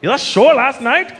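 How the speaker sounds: put-on slow, emphatic praise in an Indian accent. Indian fan praising last night's show, emphatic Indian accent. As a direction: gush like an Indian fan, drawing the words out. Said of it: "emphatic with their compliments".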